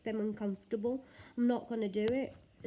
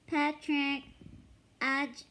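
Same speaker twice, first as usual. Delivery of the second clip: Hindi SpongeBob voice; 6 semitones higher, much slower than usual. Retold or imitated. Vlogger starting the Hindi SpongeBob dub, high. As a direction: lift into a bright dubbed-cartoon voice. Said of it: "Hindi version of the SpongeBob".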